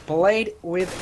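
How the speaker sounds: rising excited play-by-play; esports caster. Acted. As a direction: build the call as the clutch unfolds. Live clutch play-by-play.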